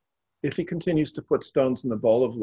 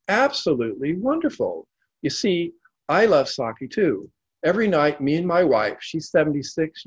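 high, lively happy telling; delighted chatter; 6 semitones higher, 4 dB louder than usual. A narrator's reading. Old man telling the drunk about his wife, delighted. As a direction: share it like a treasured secret. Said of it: "said with delight".

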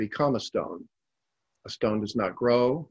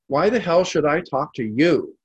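put-on loud, higher drunken challenge; belligerent; 5 semitones higher, 6 dB louder than usual. A narrator's reading. Drunk towering over the old man, belligerent snarl. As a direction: throw it down at him.

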